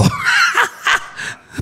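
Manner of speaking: sudden high loud laugh; burst of laughter. Real, not acted. Laughs at his own story. (carcajada aguda y fuerte)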